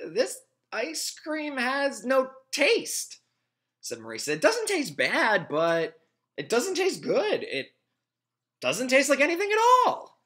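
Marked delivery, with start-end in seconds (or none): 0.1-3.2 put-on girl voice. Morisa voiced in story. 4.3-10.2 put-on girl voice. Morisa voiced in story.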